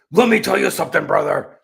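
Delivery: mock-cool wrestler growl; a touch of Hulk-style promo. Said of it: "he's not that cool".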